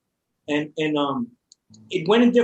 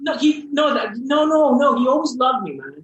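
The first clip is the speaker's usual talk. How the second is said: emphatic denial; 9 semitones higher, 6 dB louder than usual. Real, not acted. Emotional insistence after father story.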